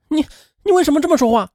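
high, fast, stuttering in rage; furious retort. A narrator's reading. Woman furious at her friend's insult, high fast outburst. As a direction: snap back in a burst of fury. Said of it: "柳红火冒三丈".

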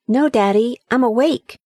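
put-on young son answering. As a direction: answer like a wide-awake little boy. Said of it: "replied the boy".

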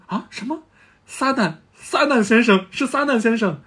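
high-pitched mock disbelief; astonished crowd. Earth's people recognising Mr. Satan, astonished.

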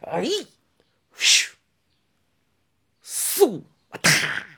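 vocal swishes and a smack; sharp mouth-made fight noises; combat sound effects. A narrator's reading. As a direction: imitate blades flying and hitting. Imitates blades flying and striking.

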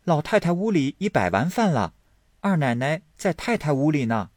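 put-on high-pitched young maid voice; high little-girl messenger; little maidservant. A narrator's reading. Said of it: "小丫头回来说".

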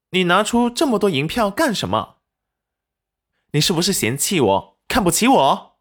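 high, accusing questions; indignant challenge. A narrator's reading. Wife challenges husband over his pile of banknotes, indignant. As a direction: question him sharply, voice rising high.

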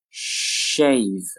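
"Shave" with a long held sh; slow careful "Shave"; drawn-out model. Shown as a demonstration. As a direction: stretch "Shave" out for learners. Teacher models word slowly, then normally.

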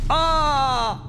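long high scream of agony; agonized scream. A narrator's reading. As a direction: scream out in burning pain. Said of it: "天宇的惨叫声传了出来".

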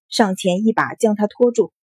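ordinary delivery, nothing marked

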